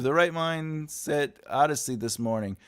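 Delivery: normal talking voice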